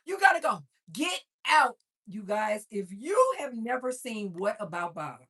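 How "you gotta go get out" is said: high, loud, screaming at him to leave; furious yell. Retold or imitated. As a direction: lose it completely and scream him out. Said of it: "He was like".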